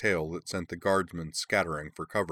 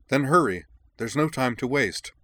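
urgent, higher and louder; insistent; 7 semitones higher, 6 dB louder than usual. Acted. Prince urging the adventurers to hurry, loud and high. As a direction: push them to move now. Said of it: "the prince insisted".

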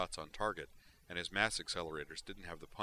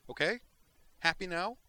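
high, loud and cutting; snapping retort; 13 semitones higher, 8 dB louder than usual. Acted. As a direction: throw the question back at him hard. Heated argument retort.

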